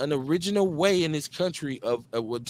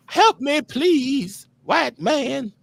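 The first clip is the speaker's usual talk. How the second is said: bad Southern accent; 10 semitones higher, 4 dB louder, much slower than usual. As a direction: ham up a damsel's cry for help in a bad Southern drawl.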